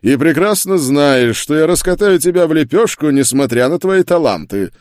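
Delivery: high, forceful, scornful threat; contemptuous. A narrator's reading. Duke threatens to crush the witch, contemptuous. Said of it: "с презрением отозвался герцог".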